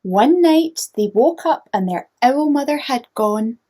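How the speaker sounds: bright, lively storybook read-aloud; reading to children. A narrator's reading. Teacher reading a picture book to young listeners, animated. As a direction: read it like bedtime story time for little ones.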